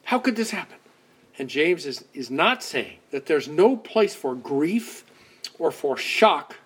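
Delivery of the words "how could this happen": anguished cry. Retold or imitated. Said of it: "And we go".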